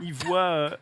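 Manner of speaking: ordinary talk, nothing marked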